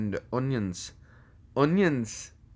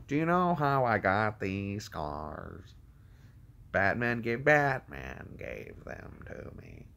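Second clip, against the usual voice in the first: slow, soft menacing villain voice; movie villain; 3 semitones lower, 5 dB quieter, slower than usual. Acted. Villain telling how he got these scars, slow low menace. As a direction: draw it out quietly, sinking very low.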